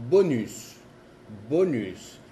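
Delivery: bonus said bo-nys, n sounded; French bonus, clear n; French bonus, not nasal. Shown as a demonstration. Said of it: "dice O N U".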